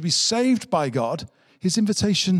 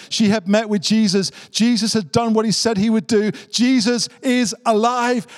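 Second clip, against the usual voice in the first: 1 rising joy; 6 semitones higher than usual. Real, not acted. Preacher telling the congregation Easter news, rising joy. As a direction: build to a joyful proclamation.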